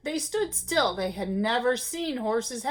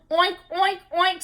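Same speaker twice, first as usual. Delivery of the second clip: higher, louder oinks; 6 semitones higher, 4 dB louder than usual. A narrator's reading. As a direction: oink as loud as you can. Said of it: "as loudly as he could".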